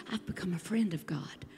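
soft whisper of Paul's awe; whispered quote. Acted. Paul whispering of becoming God's friend. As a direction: breathe it as a secret. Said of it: "he grows and he says".

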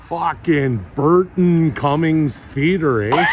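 put-on slow, loud Canadian accent with a drawn-out eh. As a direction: shout the sign out slowly in a mock Canadian accent. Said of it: "reading signs in my Canadian accent".

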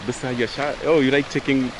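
ordinary delivery

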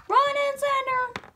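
high, drawn-out summons; calling out. Acted. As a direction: call them up front, stretching it. Pony calling out to take position.

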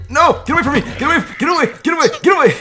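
loud, high, rapid-fire repeated cries; panicked shouting. Real, not acted. Player tells attacker get away, high panicked shouting. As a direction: shout them off in a playful panic. Player fleeing an opponent in game.

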